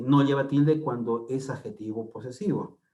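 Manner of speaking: usual speaking voice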